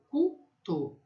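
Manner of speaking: slow "cuto" split as cu, to. Shown as a demonstration. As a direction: sound out "cuto" slowly with a closed final o. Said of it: "O U tem som de U".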